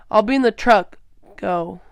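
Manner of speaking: plain reading voice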